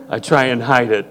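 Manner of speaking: ordinary delivery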